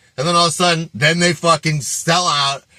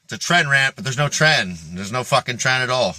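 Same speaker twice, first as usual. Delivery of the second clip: ordinary talk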